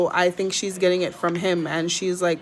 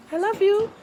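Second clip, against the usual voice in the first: much higher, cooing to the bird; high baby talk; 14 semitones higher than usual. Acted. Owner cooing love to her parrot, high baby talk. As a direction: squeak it like a tiny bird.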